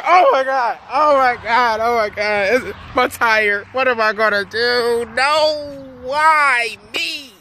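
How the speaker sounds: high, whiny fake sobbing lament; hammed-up whimpering about the tire, pitched high; mock crying. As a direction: wail like a baby over the flat. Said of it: "Shut that crying up".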